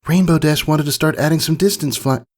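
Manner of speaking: high filly voice, cut off mid-word; young filly. Acted. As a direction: explain quickly before being interrupted. Scootaloo answering, cut off.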